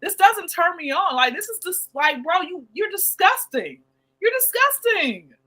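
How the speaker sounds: grossed-out high voice; disgusted. Retold or imitated. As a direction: recoil at the creep's message. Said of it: "And I'm like".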